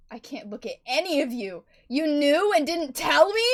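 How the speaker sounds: furious roaring accusation; roared. Acted. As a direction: roar the betrayal. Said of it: "she roared out".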